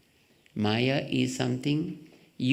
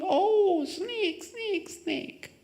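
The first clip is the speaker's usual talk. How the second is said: high, panicked cries of snake; crying out in fright; much slower than usual. Retold or imitated. Speaker acting out a terrified person seeing a rope, high frightened cry. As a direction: shriek it like you saw a snake. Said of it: "and then you start crying".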